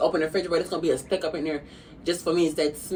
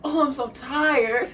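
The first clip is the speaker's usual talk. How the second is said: exaggerated tired; 9 semitones higher, much slower than usual. Acted tired quote.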